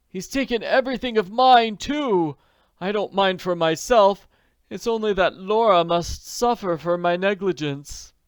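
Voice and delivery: put-on high, loud, anguished older man; distressed old man. A narrator's reading. Jacob Aborn blames himself to Nancy, high and distressed. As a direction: plead in a strained, high voice.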